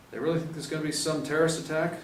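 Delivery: eager rookie. Acted. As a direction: ask like an excited rookie. Rookie Diego asks eagerly.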